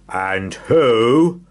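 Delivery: loud booming giant; thundering giant. Acted. Giant holding Mister Greedy booms a loud question. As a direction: boom it out like a huge giant. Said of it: "thundered the giant".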